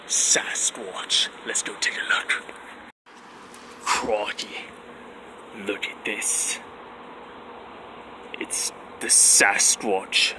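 whispered wildlife host, slow and hushed; hushed nature documentary. Acted. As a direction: hush it like a nature-show host stalking an animal.

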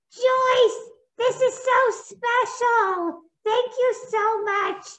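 a toy hero deeply moved by a gift; very high, slow grateful puppet; touched Lego Man. Acted. Lego Man thanks Joyce.

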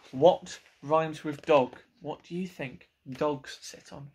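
usual speaking voice

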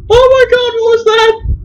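very high, loud panicked squeal; genuinely spooked by a voice; shrieking in real fright; terrified shriek. Real, not acted. Terrifying voice heard.

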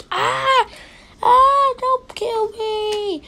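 high, loud, slowing mock plea; pretend terrified scream. Victim begging not to be killed, pretend terror. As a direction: scream and beg in play panic.

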